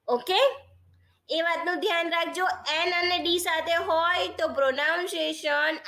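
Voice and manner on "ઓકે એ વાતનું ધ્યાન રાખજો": ordinary talk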